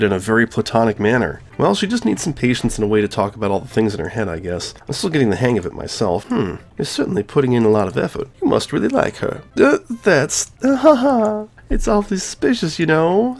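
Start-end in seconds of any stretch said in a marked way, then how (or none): 6.2-13.4 much higher, teasing club president voice; Monika voice. Acted. Gamer voicing Monika teasing the player about Yuri. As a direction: play her as a knowing, teasing schoolgirl.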